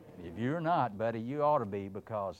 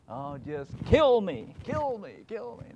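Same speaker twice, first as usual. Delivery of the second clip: hammed-up slow pleading, then higher; mock despair; 9 semitones higher, 7 dB louder, slower than usual. Preacher as Elijah, mock-wailing to God. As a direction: beg to die, half-joking.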